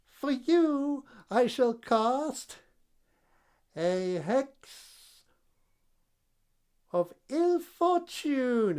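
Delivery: high, slow cackling hag; witch voice. Acted. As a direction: cast the hex like a gloating witch.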